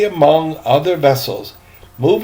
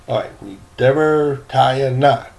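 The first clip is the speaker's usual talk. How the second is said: ordinary delivery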